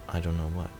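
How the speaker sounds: normal talking voice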